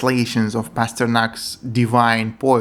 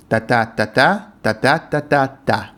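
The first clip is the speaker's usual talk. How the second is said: da-da beats with the last syllable da added unstressed; iambic beat with extra syllable. Shown as a demonstration. Showing the feminine ending of the next line, tapped da-da beats. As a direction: tap out the meter, then add one light extra syllable. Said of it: "masculine and feminine endings, also in pairs".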